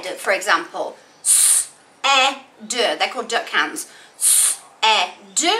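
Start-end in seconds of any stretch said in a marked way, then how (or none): 1.2-2.8 segmenting said into sounds; s, e, d split slowly sound by sound. Shown as a demonstration. Teacher sounding out s, e, d for pupils. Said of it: "I need to think said". 4.2-5.5 S, e, d as three separate sounds; sounded out. Shown as a demonstration. Teacher sounding out said to the class. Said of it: "I heard three sounds".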